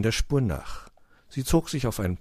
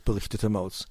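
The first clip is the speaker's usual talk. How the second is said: plain reading voice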